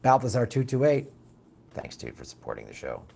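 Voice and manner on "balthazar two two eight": usual speaking voice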